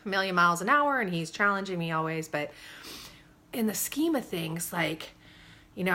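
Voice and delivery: ordinary delivery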